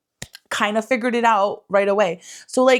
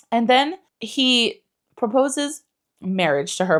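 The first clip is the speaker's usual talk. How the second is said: usual speaking voice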